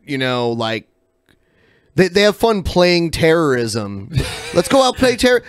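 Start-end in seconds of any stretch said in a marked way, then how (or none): 4.5-5.4 much higher, laughing mock invitation; mock playfulness. Host mimics people who play at terrorism, mock-playful. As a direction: pretend it's all a fun game.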